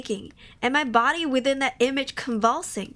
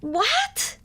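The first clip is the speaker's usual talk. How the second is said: baffled what; 11 semitones higher than usual. Real, not acted. Confused by story.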